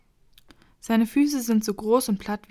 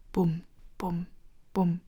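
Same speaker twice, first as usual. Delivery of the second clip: heartbeat sounds; 2 semitones lower, 4 dB quieter, slower than usual. A narrator's reading.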